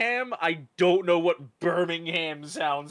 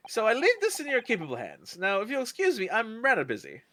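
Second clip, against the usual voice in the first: lightly voiced posh detective; 6 semitones higher than usual. Voicing posh Sherlock character.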